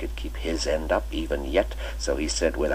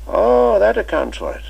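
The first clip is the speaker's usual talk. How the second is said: put-on much higher and louder, playful old schoolmaster; twinkling old master; 10 dB louder than usual. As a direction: cap their joke with a merry invitation to tea. Chips realizes.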